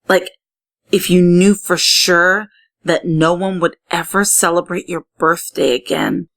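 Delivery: ordinary delivery, nothing marked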